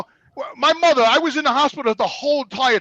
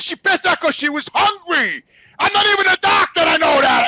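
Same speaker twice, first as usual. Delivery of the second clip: higher, exasperated shouted retort; angry shouting; 7 semitones higher, 3 dB louder than usual. Retold or imitated. Son arguing mother was just hungry, angry shout. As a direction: shout the obvious in outrage. Acted quarrel with the test technician.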